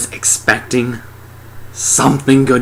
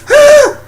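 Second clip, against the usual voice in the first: very high, loud comic shriek; startled scream; 10 dB louder than usual. Acted. They see the alien pop up, startled shriek. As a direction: shriek as the monster pops up. Imitating their reaction to the alien.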